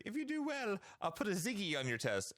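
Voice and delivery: put-on a light, high-pitched British teacher accent; lightly imitated British schoolteacher. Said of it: "a little British accent".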